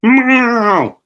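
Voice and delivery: high plaintive cat meow; long high meow; crying cat meow. A narrator's reading. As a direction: cry it out like a cat. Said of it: "the cat cried".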